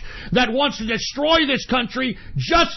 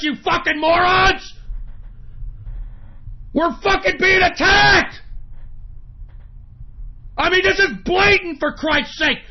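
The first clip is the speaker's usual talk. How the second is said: angry shouting; 4 semitones higher than usual. Real, not acted. Ranting host yells at his audience, furious shout. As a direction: bark it out in real outrage. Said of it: "the reason I'm getting so pissed off".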